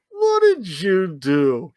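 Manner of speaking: slow, drawn-out insistent question; slow, put-on knowing prod; mock accusing. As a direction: stretch the words and press for a confession. Repeats the prodding question to friend.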